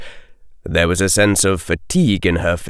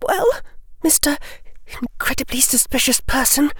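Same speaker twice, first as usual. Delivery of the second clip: put-on high girlish voice for Hermione; much higher girl voice, demanding; young girl's voice; 4 dB quieter, faster than usual. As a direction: play the bright, bold schoolgirl.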